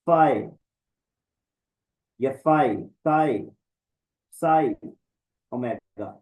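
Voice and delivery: फाई, ताई, साई rhyming with "eye"; "eye"-ending letter names. Shown as a demonstration. Teacher gives one of two pronunciations, "eye" endings on फाई, साई. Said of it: "दोनों तरह से आप बोल सकते हैं".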